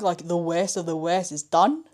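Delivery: normal talking voice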